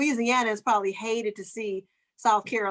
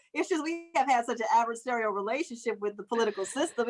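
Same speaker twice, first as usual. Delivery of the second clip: ordinary delivery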